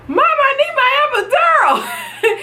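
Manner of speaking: high laughing wail for the epidural; mock sobbing plea; laughing cry. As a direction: beg through tears and laughter. Begging mom for drugs.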